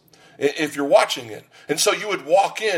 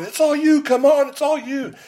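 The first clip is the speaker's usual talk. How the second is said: much higher, hollered gym cheer; hollering; 12 semitones higher than usual. Retold or imitated. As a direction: holler it like a spotter pushing his buddy. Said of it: "he's hollering".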